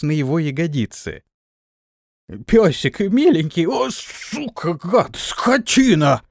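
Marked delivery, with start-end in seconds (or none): 2.5-3.7 high, loud coaxing plea; pleading with a dog. A narrator's reading. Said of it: "заорал Антон". 3.7-6.2 slow, high yelled curses; yelling. A narrator's reading. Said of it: "заорал Антон".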